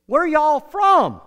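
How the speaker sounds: high, loud, drawn-out question; loud imitation of a chatty stranger; hearty hail. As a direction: call it out slowly to the diners. Old man hails the diners.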